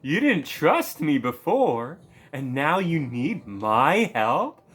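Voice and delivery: loud, drawn-out sarcastic indignation; hammed-up contempt for someone begging; mock scornful. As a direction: throw their plea back at them, slowly. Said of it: "disgusting shithead look possible".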